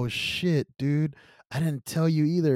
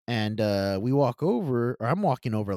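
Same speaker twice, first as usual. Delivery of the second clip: ordinary delivery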